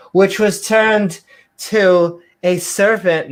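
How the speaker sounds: theatrical Bible reading; mock-biblical reading. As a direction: declaim it like hammed-up scripture. Resumes mock King James reading.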